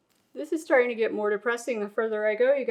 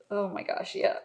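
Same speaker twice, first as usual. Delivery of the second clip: ordinary delivery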